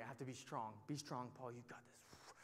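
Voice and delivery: low, hushed self-talk, fading to a whisper; inner pep talk. Retold or imitated. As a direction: psych yourself up under your breath. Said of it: "I'm freaking out inside".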